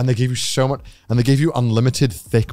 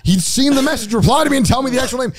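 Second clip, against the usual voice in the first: ramp up the indignant why; mock outraged; 6 semitones higher than usual. Retold outrage, shout heard.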